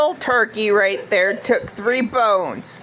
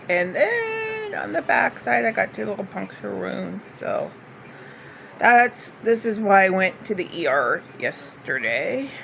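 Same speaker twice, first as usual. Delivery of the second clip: ordinary delivery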